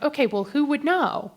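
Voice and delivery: high-pitched, barely contained question; comically strained composure; mock calm. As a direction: pretend calm while pitch climbs. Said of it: "So I very calmly".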